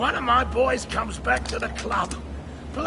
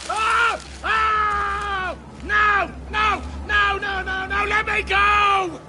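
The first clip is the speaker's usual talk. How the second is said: terrified screaming; 7 semitones higher, slower than usual. Acted. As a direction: scream for your life. Thug dragged out, terrified.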